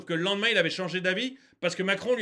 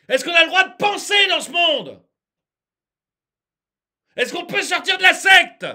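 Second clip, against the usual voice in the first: exasperated shouting, raised pitch; getting worked up; 6 semitones higher, 9 dB louder than usual. Real, not acted. Ranter begs them to abandon the scheme, worked up and shouting. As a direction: build to a high, loud, exasperated shout. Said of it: "je m'énerve".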